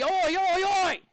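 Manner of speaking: loud, high Australian chant; big Aussie cheer. Pretend. Teacher rallying the class for a big Aussie "oi", loud cheer. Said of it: "Can I get a big Aussie?".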